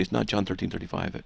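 usual speaking voice

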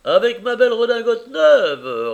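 beaming proud repeat; proud echo. Acted. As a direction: throw it back proudly, up high and loud. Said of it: "répondit Caleb".

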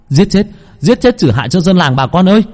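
high, loud furious chant; crowd yelling. Acted. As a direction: roar with the mob. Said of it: "Mọi người đồng loạt la lên". (cả làng la hét giận dữ)

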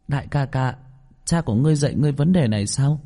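put-on high child voice, politely puzzled; questioning little boy. A narrator's reading. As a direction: ask big brother like a small boy. Boy questions big brother. (giọng bé trai cao, thắc mắc)

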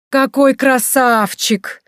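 slow, high, loud admiring exclamation; loud gush. A narrator's reading. Maria in disguise distracting the baron, loud slow gush. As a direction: gush over him loudly. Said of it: "громко сказала Мария".

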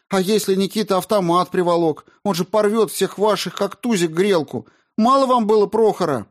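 high, loud, furious pleading; angry outburst. A narrator's reading. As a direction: lose control and lash out. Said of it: "не в силах больше сдерживаться".

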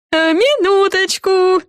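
high, drawn-out sugary trill; sweet singsong. A narrator's reading. Café owner stalling those at the door, sweet high singsong. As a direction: sing it out sweetly, very high and slow. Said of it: "мило пропела миссис Клаттербакс".

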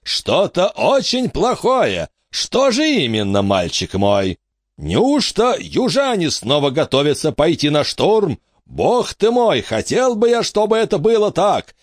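lightly voiced greedy old tour guide; pompous showman. A narrator's reading. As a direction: hawk the battle show like a carnival barker. Wicks blusters to the crowd.

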